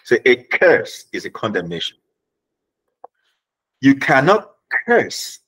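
Ghanaian accent on "curse". Shown as a demonstration. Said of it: "Let me sp-, speak like a Ghanaian".

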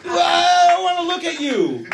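high-pitched, exasperated retort; mock indignant yell. Arguing with girlfriend about FaceTime, mock indignant yell. Acted quarrel over FaceTime.

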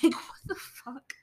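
slow, voiceless whisper of disbelief. Retold or imitated. Viewer baffled by the townspeople's overreaction, slow incredulous whisper. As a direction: whisper it slowly, utterly baffled. Acted disbelief after the rant.

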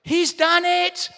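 high, loud, triumphant cry; excited eruption. Acted. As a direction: build to an exultant shout, stretching the last words. Preacher acting out eruption in heaven.

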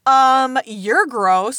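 loud, disdainful put-on voice; disgusted mom. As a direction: sneer at the cheap bottle. Imagined mom judging cheap drink.

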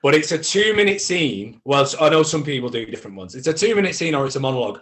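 loud, high, impassioned reassurance; emphatic pep talk. Real, not acted. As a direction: drive it home like a rousing coach. Emphatic pep talk about showcase nerves.

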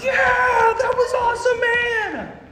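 very high, loud, slow laughing yell; ecstatic shout. Retold or imitated. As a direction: whoop it out like a kid in a dogpile. Kids dogpiling after his jump.

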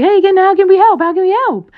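high, loud, sugary mock voice of pushy allies; hammed-up high do-gooder voice; mocking eager helper. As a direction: squeal it like a fake-eager helper offering aid. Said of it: "be up your ass and be like".

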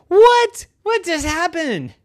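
high squeak of shock on what; jolted out of the game; shocked gasp of a question; startled. Acted. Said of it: "And Hope said".

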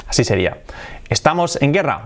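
ordinary talk, nothing marked

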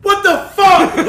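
high, loud shocked outburst; shocked girlfriend. Retold or imitated. Girlfriend cleaning the fridge finds teeth, loud shocked cry. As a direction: blurt it out, stunned. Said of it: "and she goes".

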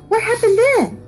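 put-on high, loud child's demand; eager child listener. A narrator's reading. Child begs the storyteller to go on, high and eager.

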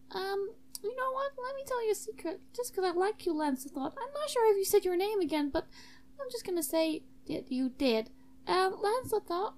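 very high character voice; confiding student host. Acted. [name] telling Lancelot a secret, high confiding voice.

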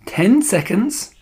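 slow countdown call, pitched high; theatrical witch's cry. A narrator's reading. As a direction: draw it out in suspense. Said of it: "cried the Grand High Witch".

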